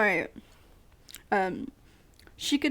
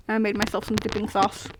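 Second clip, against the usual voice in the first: ordinary talk, nothing marked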